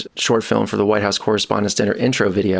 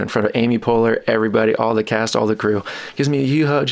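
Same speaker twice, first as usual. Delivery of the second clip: ordinary talk, nothing marked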